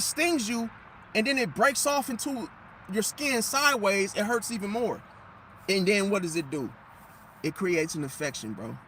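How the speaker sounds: ordinary talk, nothing marked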